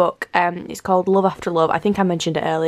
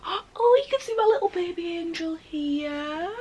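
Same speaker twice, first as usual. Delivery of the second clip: adoring coo; 8 semitones higher, 4 dB quieter than usual. Real, not acted. Vlogger shows off her little baby angel, cooing. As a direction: coo it sweetly in a high voice.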